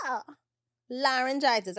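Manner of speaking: normal talking voice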